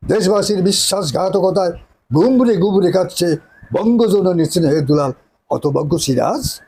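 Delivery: grand theatrical declamation as a nawab; hammy stage actor. Acted. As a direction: declaim it like a tragic stage hero. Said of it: "নায়কের dialogue আলাদা".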